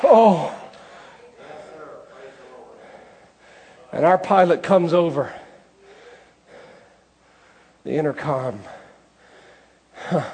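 high, loud, building sermon climax; a preacher rousing the congregation to trust Jesus; full-force preaching. Acted.

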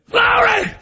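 high, loud cry of Glory; preacher exulting at full voice; shouted praise. Real, not acted. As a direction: throw it to the rafters.

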